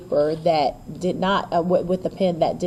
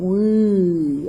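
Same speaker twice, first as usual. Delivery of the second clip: WE stretched out long; playful, drawn-out WE; mock good-time WE. Shown as a demonstration. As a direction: drag WE out like you're having fun. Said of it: "you sound like you're just having".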